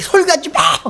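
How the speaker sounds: high-pitched imitation of a demonic outburst; possessed man's voice. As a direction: act out the possessed woman's yell. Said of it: "남자 목소리가 이렇게".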